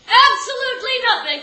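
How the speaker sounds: loud, high "Absolutely nothing!"; comic shout. Real, not acted. Mother shouting the showcase's result to the audience. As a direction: yell the punchline.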